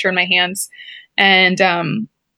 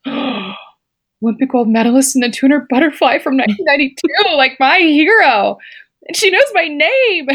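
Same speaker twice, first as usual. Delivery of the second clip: high, giddy and laughing through it; breathless fan gushing over her hero; starstruck; 6 semitones higher than usual. Retold or imitated.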